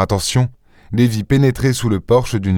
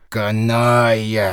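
slow insult chewed under the breath; muttered insult; much slower than usual. A narrator's reading. Ganimard chewing out an insult at Lupin, slow mutter. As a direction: chew the word slowly, barely opening the mouth. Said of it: "mâchonna Ganimard".

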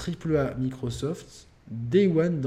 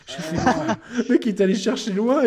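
laughing; 8 semitones higher, 6 dB louder than usual. Real, not acted. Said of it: "laughing retelling".

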